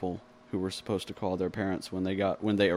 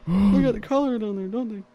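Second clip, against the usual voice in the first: high character voice; 12 semitones higher, 8 dB louder than usual. Retold or imitated. Quoting character voice.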